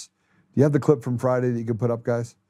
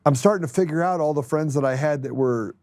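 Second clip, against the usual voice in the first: usual speaking voice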